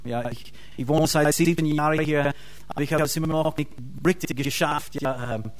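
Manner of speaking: ordinary talk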